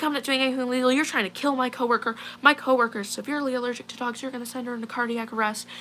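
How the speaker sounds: high, hurried mock rant; imitated irate accuser. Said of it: "screaming in my face".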